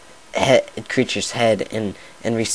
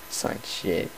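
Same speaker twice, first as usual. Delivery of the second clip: laughing aside; 4 semitones lower, 7 dB quieter than usual. Real, not acted.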